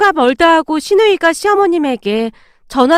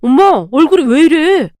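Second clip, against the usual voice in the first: alarmed call. Acted. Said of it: "남편이 놀란 듯 외쳤어요".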